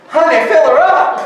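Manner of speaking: loud, high, laughing as she delivers it; grandmother's retort, barely held together with laughter; sassy grandmother's retort through laughter; laughing Nanny quote. Retold or imitated. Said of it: "To which Nanny responded".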